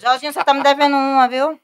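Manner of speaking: teasing call-out. Real, not acted. Guest teases a friend who owes her one, loud and playful. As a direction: needle him cheerfully about the debt.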